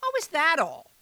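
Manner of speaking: put-on high, cheerful amused old woman; fairy godmother. A narrator's reading. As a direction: brush the worry aside brightly. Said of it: "said fairy godmother".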